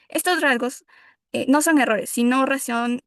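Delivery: ordinary talk, nothing marked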